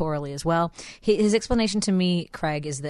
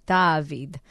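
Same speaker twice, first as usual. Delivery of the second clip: ordinary talk, nothing marked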